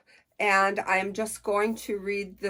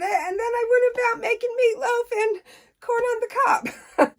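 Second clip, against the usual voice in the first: laughing; 6 dB louder than usual. Real, not acted. Laughing at her old routine.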